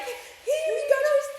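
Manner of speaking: walk in all happy and announce it; cheerful greeting. Retold or imitated. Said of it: "we're all like".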